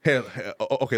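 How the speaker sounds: normal talking voice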